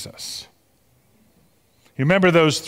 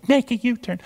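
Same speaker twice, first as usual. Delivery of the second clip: high-pitched imitation of a satnav; female GPS voice; 9 semitones higher than usual. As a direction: mimic a GPS lady giving directions. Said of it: "this wonderful female voice who tells us".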